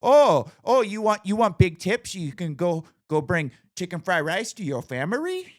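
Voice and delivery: very high, loud mocking put-on accent; mocking her accent. Speaker mocking the masseuse back, very high loud caricature. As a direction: taunt her in a shrill caricature voice. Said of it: "I'm like".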